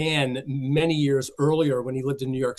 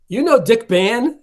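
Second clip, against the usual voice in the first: surprised question; 8 semitones higher, 7 dB louder, slower than usual. Retold or imitated. Hal Roach's surprised question retold.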